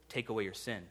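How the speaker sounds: ordinary talk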